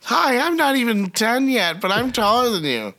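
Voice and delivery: high mock kid voice; mock little girl Lisa. Tall kid taunts the shorter adult, high mock child voice. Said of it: "By the way, my name's Lisa".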